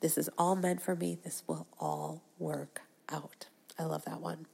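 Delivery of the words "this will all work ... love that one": hushed, breathy whisper to herself; whispered aside. Real, not acted. Podcaster whispering a personal aside to listeners. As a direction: drop to a whisper.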